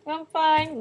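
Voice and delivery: high-pitched mock tearful reply; retold crying. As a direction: imitate insisting through tears. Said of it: "I'm, like, crying".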